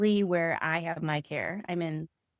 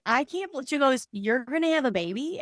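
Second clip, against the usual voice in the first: disbelieving; 7 semitones higher than usual. Retold or imitated. Friend's disbelief retold.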